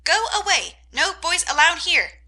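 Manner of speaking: high, loud parrot squawk; shrill bird calling boys away. A narrator's reading. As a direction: screech it like a cranky parrot. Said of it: "the parrot ... called out".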